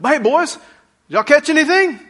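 put-on high, loud friendly call to the boys; buddy calling out. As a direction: call out to your fishing buddies, teasing. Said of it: "a relational term like".

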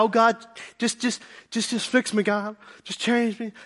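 lightly acted pleading prayer; praying believer. Congregant begging God to change him, pleading. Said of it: "we pray and ask God".

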